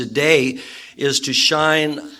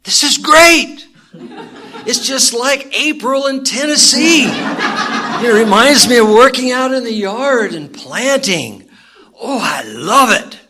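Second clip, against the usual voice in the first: much higher and louder, beaming with delight; big happy grin; 11 semitones higher, 8 dB louder than usual. Acted. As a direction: gush like it's the best day ever. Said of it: "And the guy replies".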